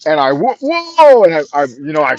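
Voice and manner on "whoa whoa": sudden high, loud startled cry; startled whoa. Retold or imitated. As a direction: jump back with a loud yelp of surprise. Kid swings a punch at him.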